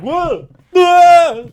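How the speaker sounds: high, loud startled yell; startled yelp. Retold or imitated. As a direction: yelp like you've been hit. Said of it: "He was just like".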